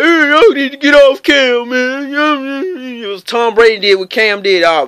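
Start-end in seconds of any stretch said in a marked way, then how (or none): 0.0-3.0 mocking fan voice. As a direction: imitate whiny fans defending Cam.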